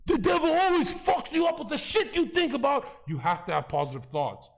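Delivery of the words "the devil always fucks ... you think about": very high-pitched heated yell; real full-force outburst; impassioned shout. Real, not acted. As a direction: shout it as the big conclusion. Climax of heated argument.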